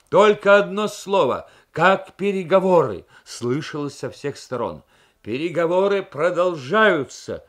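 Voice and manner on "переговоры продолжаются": loud, high shout over the crowd. A narrator's reading. Krasin shouts the password slogan to the crowd, loud shout. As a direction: Krasin yells the slogan at reporters. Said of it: "выкрикнул Красин".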